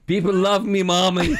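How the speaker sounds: high, loud cry of playful glee; mock elation. Guest jokes to mommy after lavish praise, mock elated. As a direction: call out to mommy, high and giddy. Mock gushing to his mother.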